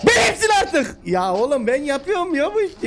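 high triumphant yell; excited shout. Real, not acted. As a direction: crow over the win.

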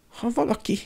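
high, mildly whimpering worry; nyöszörgés. A narrator's reading. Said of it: "nyöszörögte Hermione". (aggódó, magas hangú nyöszörgés)

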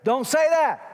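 high, loud acted reprimand; parent scolding kids. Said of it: "When you're talking to your kids, how do you say that?".

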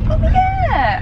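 leaps up in sudden recognition; suddenly remember it; surprised oh yeah. Real, not acted. Friend remembering the Chloe Paddington bag, surprised.

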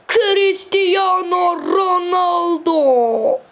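long drawn goal cry of delight; goal celebration cry. Real, not acted. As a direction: scream the scorer's name in pure joy.

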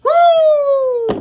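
very high, loud woo; woo shout. Real, not acted. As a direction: whoop in celebration. Celebrating the bird call.

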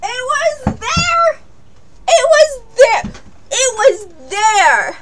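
high, slow anguished cry of It was there; despairing wail. Real, not acted. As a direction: wail it out in disbelief. Finds the missed heart, despairing.

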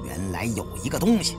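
ordinary delivery

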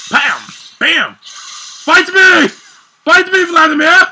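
high, loud challenge; shouting a dare at the enemy; yelled taunt. Real, not acted. Streamer yelling a taunt at Vladimir, high and loud.

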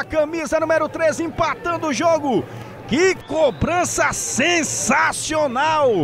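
high, fast-building praise; excited commentary. Real, not acted. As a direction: still thrilled after the goal. Praising the goal after the call.

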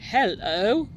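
lightly voiced gruff bear hello; a hint of a grumpy bear. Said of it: "says a gruff, grisly voice".

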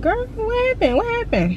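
high-pitched mock concerned question; squeaky put-on viewer voice; imitating nosy viewers. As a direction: pipe up high like curious followers asking. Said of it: "y'all probably gonna be like".

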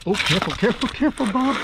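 rising high-pitched "careful" to the lizard; high warning call. Real, not acted. Host calls to the climbing lizard Bob, high and fretful. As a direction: coax the lizard back, pitch jumping up.

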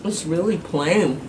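talking between bites; while chewing. Real, not acted. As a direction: mouth partly full. Seen 1: Blue-shirt speaker chews with lips pressed, grimacing between words.

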